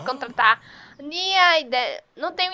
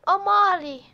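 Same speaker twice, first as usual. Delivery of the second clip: voicing the horse; 5 semitones higher than usual. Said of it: "ele tá dizendo".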